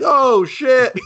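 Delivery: high mock panic yelp; high mock alarm. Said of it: "He's like".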